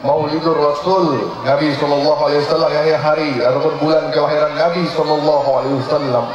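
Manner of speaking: ordinary talk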